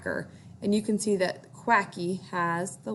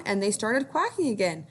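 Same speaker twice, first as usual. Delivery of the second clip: ordinary delivery, nothing marked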